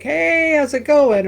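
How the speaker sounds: high, loud breezy hey; casual greeting. Shown as a demonstration. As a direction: sound like a friend passing by. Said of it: "Was it to be like".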